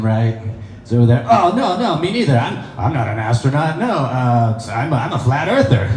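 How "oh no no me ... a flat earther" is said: hint of a flustered American astronaut; American astronaut matching him. American astronaut playing dumb to match the Russian, lightly flustered. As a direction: deny it hurriedly, pitched up at first. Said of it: "the American guy has to match him".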